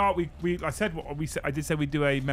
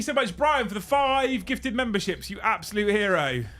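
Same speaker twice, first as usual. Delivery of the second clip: excited thanks; 8 semitones higher, 3 dB louder than usual. Real, not acted. Thanking gifter, repeated.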